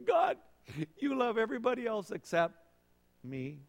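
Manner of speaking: high, sulky mock whine fading to nothing; whiny pity party. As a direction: sulk like a left-out child. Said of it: "Kind of a little pity party with God".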